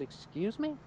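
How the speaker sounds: quietly incredulous, imitated; taken aback. Cop answering a driver's insult, taken aback.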